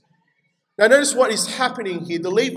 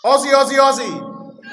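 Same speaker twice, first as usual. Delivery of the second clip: high, loud sporting chant; crowd call; 5 semitones higher, 7 dB louder than usual. Shown as a demonstration. Preacher prompts the church to respond, chant shouted out. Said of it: "I'm gonna say something and I'm gonna expect you to respond".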